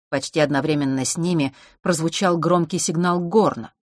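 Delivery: usual speaking voice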